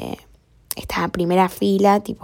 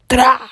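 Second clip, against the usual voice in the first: loud sharp bang sound; table bang; 4 semitones higher, 6 dB louder than usual. Retold or imitated. Teacher bangs the table. (golpe fuerte en la mesa)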